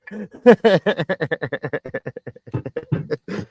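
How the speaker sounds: high, drawn-out laugh; long laugh. Real, not acted. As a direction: let out a long, rolling laugh. Laughs at own coyote boast.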